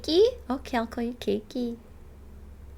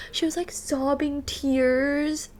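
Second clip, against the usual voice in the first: ordinary talk, nothing marked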